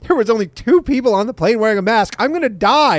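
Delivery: high-pitched overblown complaint; mock alarm. Mocking a reporter unmasked on planes, exaggerated alarm.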